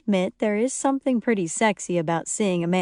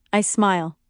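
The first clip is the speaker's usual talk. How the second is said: plain reading voice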